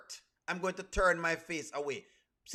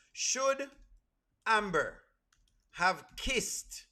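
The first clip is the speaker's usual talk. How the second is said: word by word, with long pauses; deliberate question; 3 semitones higher, much slower than usual. Real, not acted. Host putting a pointed question to Amber fans, deliberate and halting. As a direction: pose it in separated chunks, rising on "kissed".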